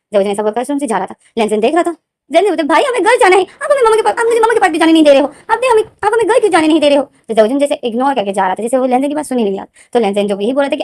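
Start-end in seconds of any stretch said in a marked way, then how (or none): 2.6-7.0 put-on high, loud tearful child's complaint; child pleading. Little brother protesting to big brother, high loud whine. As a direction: wail at big brother like a hurt kid.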